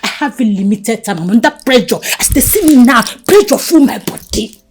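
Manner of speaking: rising from firm to loud and high; building desperate shout about needing money; pressured outburst. Acted. Woman under pressure shouting at Jennifer.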